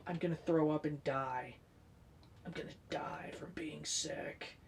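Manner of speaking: hushed mock-dying whisper, mostly breath; dramatic whisper. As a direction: whisper it like you're about to die. Fearful sick self quoted.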